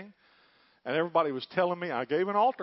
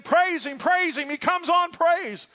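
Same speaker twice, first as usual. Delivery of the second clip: much higher, louder, insistent chant of "praise him"; imitating a zealous saint; 12 semitones higher, 8 dB louder, slower than usual. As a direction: egg the seeker on like an eager church elder. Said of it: "another one saying".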